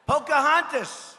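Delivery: usual speaking voice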